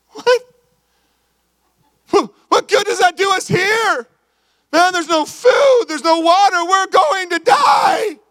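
high, slow panicked shout. Acted. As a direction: yell it like a castaway sure of doom. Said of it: "The other guy's like".

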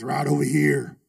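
low, slow gruff biker voice; biker drawl. Retold or imitated. Biker inviting the student in, low and slow. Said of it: "He talked like that too".

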